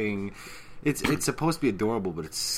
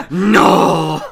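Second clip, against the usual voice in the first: long, loud, higher "no"; mock outraged yell; 8 semitones higher, 18 dB louder than usual. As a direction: bellow a drawn-out refusal. Mock outcry in acted joke.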